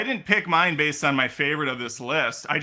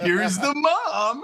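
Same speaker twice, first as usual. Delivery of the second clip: drawn-out, higher, laughing announcement; mock big reveal; 7 semitones higher, much slower than usual. Host mimicking a film's slow-roll reveal of the mom, mock reveal. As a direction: present it like a grand reveal, stretched out. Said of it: "where they're like".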